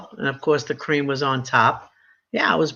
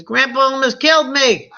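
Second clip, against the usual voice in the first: put-on higher teen complaint; son's complaint; 7 semitones higher, 4 dB louder than usual. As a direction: whine it like a bruised teenager. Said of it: "he says".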